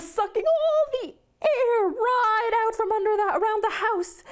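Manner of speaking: high, straining as if inhaling hard; strained high voice. A narrator's reading. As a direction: squeeze the words out while sucking air. John sucking in air.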